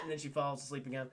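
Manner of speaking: ordinary delivery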